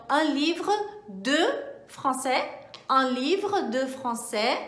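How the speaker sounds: "Un livre de français" said slowly in careful French; slow model sentence for students; slow French demo. Shown as a demonstration. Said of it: "Então".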